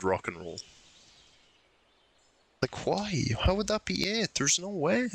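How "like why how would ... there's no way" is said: much higher, disbelieving protest; incredulous disbelief. Real, not acted. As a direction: protest in high-pitched disbelief that it could be that. Exasperated at puzzle answer.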